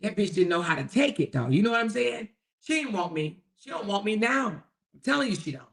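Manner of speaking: ordinary talk, nothing marked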